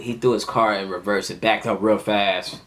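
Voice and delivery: ordinary talk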